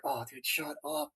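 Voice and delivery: soft, high hushed plea to a friend. Retold or imitated. Recruit hushing the classmate beside him, high and hushed. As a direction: hiss it under your breath, begging him to stop. Hushing Shaky during Pines' speech.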